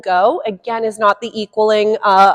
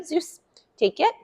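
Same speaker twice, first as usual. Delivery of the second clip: dog cue voice; 6 semitones higher, 7 dB quieter than usual. Real, not acted. Trainer cueing dog in high voice.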